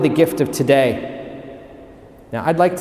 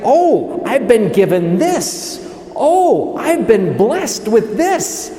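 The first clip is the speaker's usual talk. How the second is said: high, delighted Oh, then slower wonder; dawning gratitude; 4 semitones higher, slower than usual. Acted. People counting their blessings, acted wondering gratitude. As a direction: marvel at a gift just noticed. Acts out realising one's blessings.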